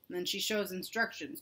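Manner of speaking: plain reading voice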